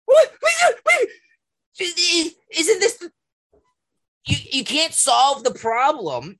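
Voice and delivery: can't believe the absurdity; incredulous. Real, not acted. Stunned at the government's logic, incredulous. Incredulous outburst mid-rant.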